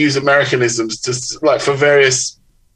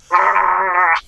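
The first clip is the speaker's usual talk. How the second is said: high, drawn-out mock-awed "aah"; 7 semitones higher than usual. Author learning of the per diem, mock-awed "aah". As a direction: let out a long revelatory "aah". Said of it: "And I was like".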